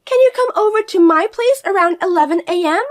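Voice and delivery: high, loud, pushy demanding voice; bossy sister-in-law. Acted. Fiona pressing her sister-in-law to come over, high and pushy. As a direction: order her around like you own her.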